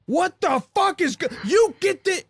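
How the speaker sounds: high, mock-outraged drill instructor yelling; drill instructor outburst. Drill instructors storming into the wrecked barracks, high mock yell. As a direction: bellow it like a furious drill sergeant.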